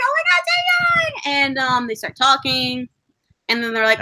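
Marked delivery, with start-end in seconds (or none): 0.0-1.2 high, slow, shrieked name; fangirl squeal. Retold or imitated. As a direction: scream like spotting your idol. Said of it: "I'm like".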